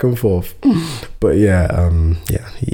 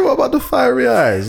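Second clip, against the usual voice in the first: high incredulous. Retold or imitated.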